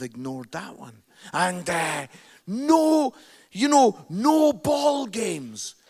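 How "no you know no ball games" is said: loud, slow, stern reading of a sign. Retold or imitated. Reading a no ball games sign to the room, loud and stern. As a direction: bark each word like a warning notice.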